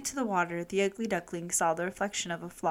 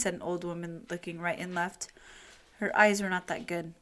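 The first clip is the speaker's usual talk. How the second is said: usual speaking voice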